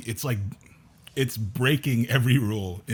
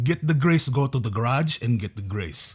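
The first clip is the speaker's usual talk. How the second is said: mad Filipino dad; 2 semitones higher than usual. Retold or imitated. Son imitating dad getting all mad in the garage, mock-angry. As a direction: imitate an exasperated Filipino dad repeating his order. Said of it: "he would get all mad".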